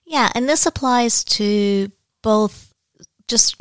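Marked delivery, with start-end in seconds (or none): none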